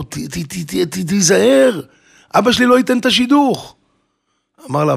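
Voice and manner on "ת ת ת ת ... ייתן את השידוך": stuttering into a high-pitched warning; acted-out warning, stumbling on the first word; stammered warning. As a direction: imitate a flustered woman stammering, then rising sharply. Bride stammers a warning at groom.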